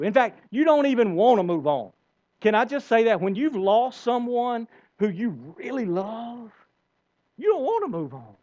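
ordinary talk

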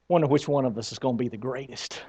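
quick, soft, conspiratorial aside; scheming murmur. Retold or imitated. Disciples scheming to each other, fast hushed murmur. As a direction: lean in and murmur it fast like a secret. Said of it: "talking to each other, going".

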